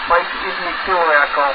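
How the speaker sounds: ordinary talk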